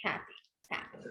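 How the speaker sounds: soft, unenthusiastic mock-happy; quietly sarcastic; imitated sarcasm. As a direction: imitate a kid being sarcastic, under the breath. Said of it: "they mean they're being sarcastic".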